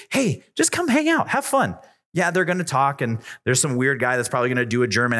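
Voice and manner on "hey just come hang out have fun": bright, much higher friendly invite; teen inviting a friend to youth night, breezy; casual invite. Pretend. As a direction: sell it like it's no big deal. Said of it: "all you have to say is".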